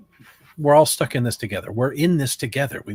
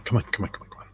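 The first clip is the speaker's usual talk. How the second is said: whispered urging; urgent whisper; 2 semitones lower, 6 dB quieter, faster than usual. Acted. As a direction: hurry them along in a whisper. Urging a friend quietly.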